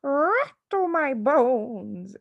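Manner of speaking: slow, high, eerie drawn-out croak; haunt the room; soft spooky parrot. Acted. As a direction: parrot sounding like a ghost. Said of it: "soft and spooky this time".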